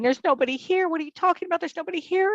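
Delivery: high, insistent quoted disbelief; baffled boyfriend. Retold or imitated. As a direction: imitate an incredulous boyfriend, pitched well up. Acted boyfriend puzzled at her screaming.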